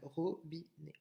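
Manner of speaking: "robinet" softly split into ro, bi, né; syllable by syllable. Shown as a demonstration. Teacher confirming the count for pupils, soft syllable-by-syllable "robinet". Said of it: "donc trois syllabes".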